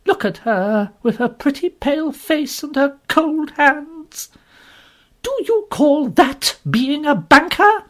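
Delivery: indignant scolding. Acted. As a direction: tear into the banker. Strong woman berating Mister Lorry.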